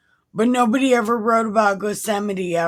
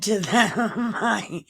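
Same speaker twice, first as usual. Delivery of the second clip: reading that gives way to giggles; breaking into laughter; 3 semitones higher than usual. Real, not acted. Reader quoting Jesus to the soldiers, cracking up. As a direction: try to get through the quote, laughing.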